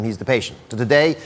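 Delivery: ordinary talk, nothing marked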